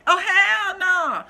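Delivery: high, slow, loud sassy "hell no"; loud drawn-out sassy refusal; sassy catchphrase. Retold or imitated. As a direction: drag it out, full sitcom sass. Imitating a Girlfriends character.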